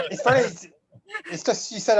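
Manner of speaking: laughing while talking; giggly, amused explanation. Real, not acted. Amused at yawning coincidence.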